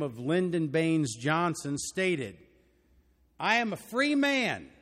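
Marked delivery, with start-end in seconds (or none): 3.4-4.7 lightly put-on Texas accent, raised and proud; a politician's declaration in a mild Texas accent. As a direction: quote it with a loud Texas twang. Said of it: "That was my Texas accent".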